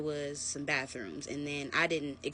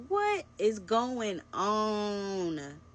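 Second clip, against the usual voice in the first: drawn-out, higher, very slow disbelieving question; stunned disbelief; 5 semitones higher, 3 dB louder, much slower than usual. Retold or imitated. Seeing him in a different outfit, drawn-out disbelief. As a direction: let the shock stretch every word. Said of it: "I instantly was like".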